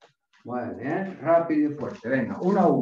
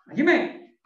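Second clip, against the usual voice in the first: barking gym coach; 8 semitones higher, 4 dB louder than usual. Real, not acted. Karate coach calling kiai command.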